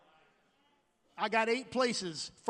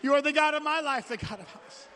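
loud, earnest prayer to God; fervent prayer; 3 semitones higher, 4 dB louder than usual. Acted. Pastor praying aloud before the congregation, fervent prayer.

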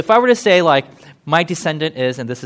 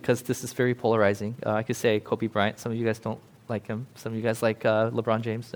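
ordinary talk

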